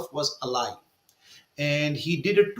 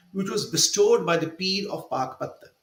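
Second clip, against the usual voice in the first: usual speaking voice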